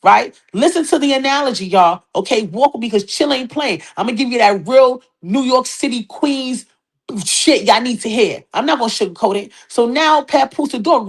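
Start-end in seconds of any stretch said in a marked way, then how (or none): none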